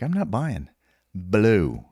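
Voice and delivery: cocky high refusal, punching Blue; mock defiant challenge. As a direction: taunt him through the door. Defying sergeant at door.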